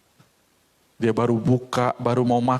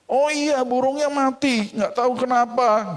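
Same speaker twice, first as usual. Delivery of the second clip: neighbour's reply; 9 semitones higher than usual. Acted. Neighbour answers.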